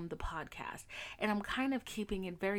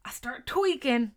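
stretched, louder "tweaking"; louder emphatic repeat; 5 semitones higher, 9 dB louder than usual. Acted. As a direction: lean hard into "tweaking".

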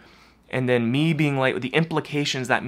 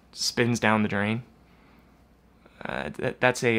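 ordinary delivery

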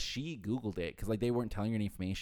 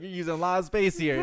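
voiced website; 8 semitones higher, 7 dB louder than usual.

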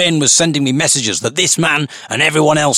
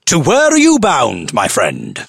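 jovially intoned; 2 semitones higher, slower than usual. Acted. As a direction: overdo it, posh and jovial. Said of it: "I jovially intoned".

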